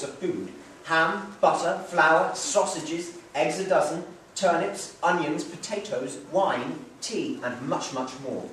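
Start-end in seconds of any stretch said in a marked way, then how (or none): none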